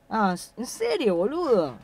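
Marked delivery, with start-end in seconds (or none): none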